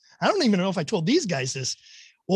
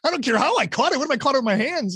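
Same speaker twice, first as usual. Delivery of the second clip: indignant protest; 8 semitones higher, faster than usual. Retold or imitated. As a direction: relive the outrage at losing your fish. Retold argument with fishing guide.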